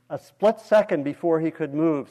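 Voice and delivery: ordinary delivery, nothing marked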